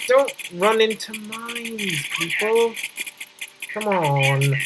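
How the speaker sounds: ordinary talk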